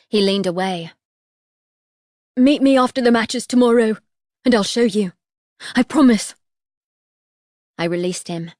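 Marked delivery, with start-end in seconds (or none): 2.3-6.3 higher, louder desperate promise. A narrator's reading. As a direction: promise anything to get away. Boy bargains in fear.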